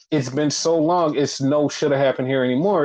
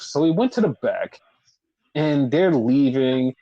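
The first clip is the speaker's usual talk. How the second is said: usual speaking voice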